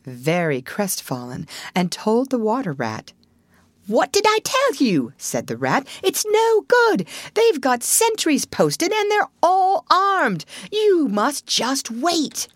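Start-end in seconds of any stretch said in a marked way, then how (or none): 3.9-5.1 high-pitched I-told-you-so; exasperated scolding. Acted. Rat scolds returning, defeated Toad. 6.0-12.5 high, drawn-out, emphatic scolding; exasperated warning. Acted. Rat scolds and warns Toad.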